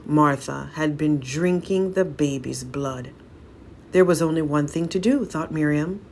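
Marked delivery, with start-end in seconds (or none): none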